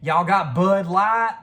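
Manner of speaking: loud, high, drawn-out Southern drawl; mock Southern. Kid making fun of someone, hollered mock Southern accent. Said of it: "a Southern person".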